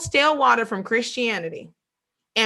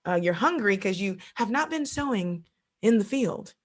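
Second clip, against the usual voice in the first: ordinary talk, nothing marked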